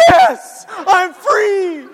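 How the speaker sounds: huge high cheer of relief; elated inner shout. Retold or imitated. Guilty son inwardly celebrates being off the hook, elated shout. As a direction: yell it out, stretched and very high. Inside of me is like.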